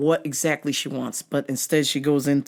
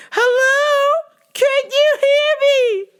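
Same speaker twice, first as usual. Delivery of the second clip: put-on very high, slow shouting old lady; old lady caller; 6 dB louder, slower than usual. Old caller yelling can you hear me, high and loud. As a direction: shout slowly into the phone.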